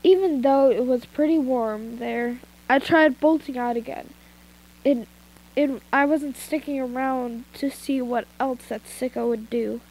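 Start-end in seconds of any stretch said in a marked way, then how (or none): none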